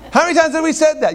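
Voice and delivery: high, quick exasperated dad; mock parental scolding. Father to daughter who jumped on the furniture, mock scolding. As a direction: re-enact a parent's reproach.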